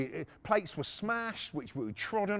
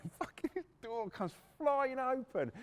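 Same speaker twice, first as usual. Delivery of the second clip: ordinary talk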